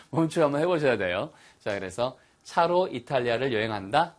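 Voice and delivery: ordinary talk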